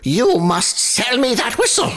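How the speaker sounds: high, greedy landlord voice; eager landlord. A narrator's reading. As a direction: landlord desperate to buy. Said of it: "said the landlord".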